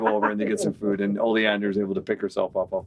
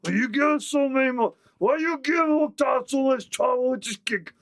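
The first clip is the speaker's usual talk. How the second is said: orc voice; 14 semitones higher than usual. Acted. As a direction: argue like a simple brute. Orc haggling over elves.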